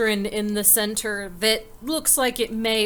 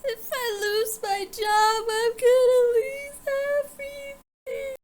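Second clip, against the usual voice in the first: high, whiny mock sobbing lament; despairing wail; 12 semitones higher than usual. Fanny frets over losing her job, high despairing wail.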